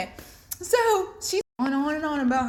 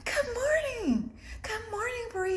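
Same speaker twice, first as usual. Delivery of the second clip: sweet coworker greeting; 12 semitones higher, 4 dB quieter, slower than usual. Retold or imitated. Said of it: "she's just like".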